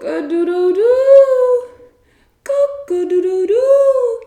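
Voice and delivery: loud, high crowing call; loud high cock-a-doodle-doo; rooster crowing. A narrator's reading. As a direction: crow like a rooster at dawn. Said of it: "cried the rooster".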